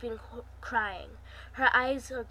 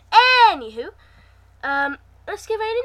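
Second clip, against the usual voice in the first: loud anywho; 9 semitones higher, 15 dB louder than usual. Real, not acted. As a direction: burst out high and loud. Loud transition to story.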